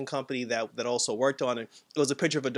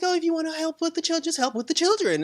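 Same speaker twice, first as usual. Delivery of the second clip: very high imitation of a sitcom wife; Wanda; 13 semitones higher than usual. Wanda talking to the neighbor about helping, high imitation. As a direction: mimic her in a raised, high voice. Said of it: "She's like".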